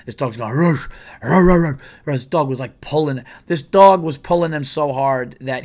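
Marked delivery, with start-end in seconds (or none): 0.5-2.2 higher, louder growly woofs; imitated dog barking. Dog barking at hikers unpacking their car, imitated barks. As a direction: bark like a dog straining on its lead. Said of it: "we hear a dog barking".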